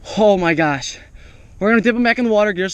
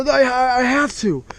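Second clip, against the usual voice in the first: normal talking voice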